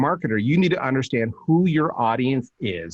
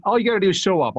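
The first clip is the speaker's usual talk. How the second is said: high, upbeat reassuring offer; high keen promise to do the work; eager pitch; 8 semitones higher, faster than usual. Retold or imitated. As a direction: sell it cheerfully. Quoting own pitch.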